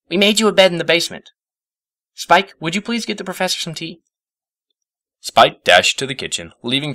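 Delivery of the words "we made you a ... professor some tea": high, fast eager voice; rushing to please; Twilight hurried. A narrator's reading. Twilight hurries to help the guest, high and fast.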